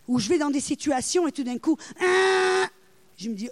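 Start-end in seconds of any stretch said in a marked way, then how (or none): 2.0-2.7 sudden high buzzer blare; buzzer imitation. Preacher's inner alarm goes off in a situation, buzzer imitation.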